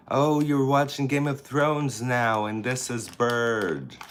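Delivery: high, loud "Oh"; light up at the news; high, loud delighted oh; delighted surprise. Real, not acted. Reacting to a comment.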